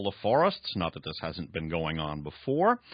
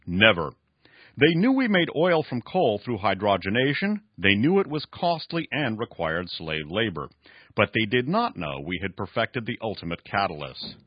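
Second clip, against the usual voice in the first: raised, emphatic story reading; dramatic novel reading; 4 semitones higher, 6 dB louder than usual. Acted. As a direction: read the villain's boast with drama. Reads novel dialogue aloud.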